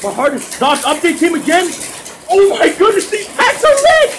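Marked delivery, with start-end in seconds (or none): 2.3-4.1 high-pitched cry, climbing higher; ecstatic outburst. Real, not acted. Gamer thrilled that the packs are lit, ecstatic. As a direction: climb into a delighted squeal.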